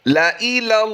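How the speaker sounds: ला इलल्लाही chanted high and melodic; Quranic recitation. Shown as a demonstration. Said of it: "last time फिर पढ़ लीजिए".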